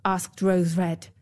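plain reading voice

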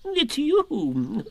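high, fussy owl voice; a fussy, self-important owl. Acted. Owl's voice.

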